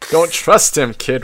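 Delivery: ordinary delivery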